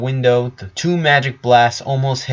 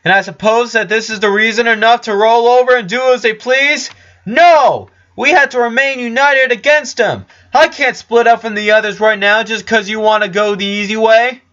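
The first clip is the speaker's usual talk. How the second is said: loud, high and defiant; raised voice; 12 semitones higher, 6 dB louder than usual. Acted. Richard shouts back at Flower from the dark, loud and defiant. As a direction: shout back your refusal from hiding. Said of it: "so he also rose his voice".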